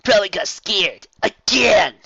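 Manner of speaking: loud scornful whine; exasperated complaint. Acted. Rainbow Dash complaining about Fluttershy, loud scorn. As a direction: roll your eyes as you say it. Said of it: "Rainbow Dash complained".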